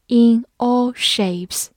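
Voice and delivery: In all shapes said slowly and clearly; slow model reading. Shown as a demonstration. Teacher slowly modelling In all shapes for class. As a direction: draw out In all shapes word by word. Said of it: "比如说，in all shapes".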